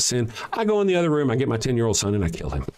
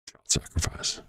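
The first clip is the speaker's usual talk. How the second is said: quiet; 7 dB quieter than usual. Shown as a demonstration. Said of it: "I'll say it again slower".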